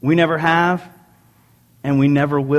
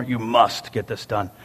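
stern boss order; 5 semitones lower, 4 dB quieter than usual. Retold or imitated. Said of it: "Not that anybody talks like that".

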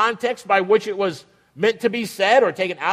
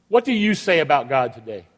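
ordinary talk, nothing marked

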